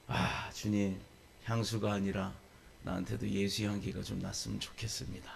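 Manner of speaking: softly murmured prayer, trailing down; lightly sighed plea to the Lord; quiet, humble wish recalled from memory; retold wistful prayer. Retelling own wistful prayer.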